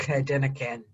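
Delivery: jokey Glaswegian impression; Glaswegian accent. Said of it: "I got the Glaswegian down pat".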